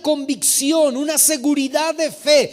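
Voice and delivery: fervent pulpit proclamation; emphatic preaching. Real, not acted. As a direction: preach it forcefully, stretching each weighty phrase. Preaches at full force.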